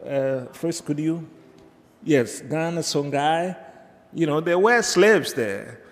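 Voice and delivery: ordinary delivery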